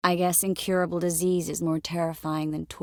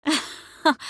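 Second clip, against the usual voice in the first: one sharp, high, loud "ha"; laugh; 7 semitones higher, 5 dB louder than usual. Acted. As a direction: burst out with a single laugh. Single burst of laughter.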